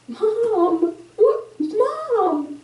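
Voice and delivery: high, breaking voice; high tearful retelling of the son's plea. Real, not acted. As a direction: let the voice crack on Mom. Son's frightened look.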